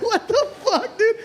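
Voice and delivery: high, laughing disbelief; laughing what the fuck. Retold or imitated. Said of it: "I was like".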